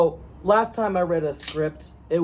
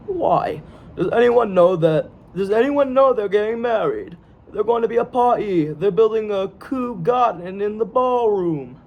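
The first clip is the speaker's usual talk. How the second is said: higher-pitched, hammed-up Cockney; bad Cockney accent; 4 semitones higher than usual. Shown as a demonstration. Actor previewing his ridiculed accent, bad Cockney. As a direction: put on a dubious Cockney, stretched at the end. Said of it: "here's the sentence".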